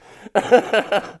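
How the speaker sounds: high burst of real laughter; loud laugh. Real, not acted. Laughing at the bird fight.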